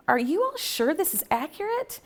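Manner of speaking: incredulous question. Retold or imitated. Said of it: "and I was like".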